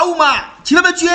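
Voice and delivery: high, loud outraged shout; high yell of protest after being scalded; acted pained shout. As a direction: shout at whoever hurt you. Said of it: "Tu cries".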